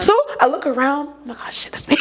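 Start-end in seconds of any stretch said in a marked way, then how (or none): 1.1-1.9 quiet, half-laughing aside to oneself; hushed realisation. Retold or imitated. Student realising the professor means her, hushed and sheepish. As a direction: mutter it under your breath, amused. Acted realisation of being singled out.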